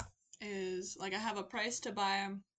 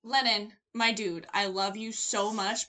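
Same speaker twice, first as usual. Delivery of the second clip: slow mock pleading; 8 dB louder, slower than usual. Real, not acted. Addressing co-host dramatically.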